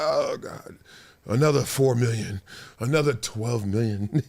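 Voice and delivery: a hint of a bored millionaire, dragged out; mock weary rich.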